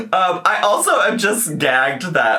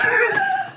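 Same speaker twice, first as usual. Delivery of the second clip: laughing. Real, not acted. Reacting to video clip.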